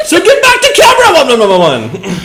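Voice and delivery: high, fast put-on voice; hammed-up fanfic voice; mock dramatic reading. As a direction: ham up the fanfic line, high and rushed.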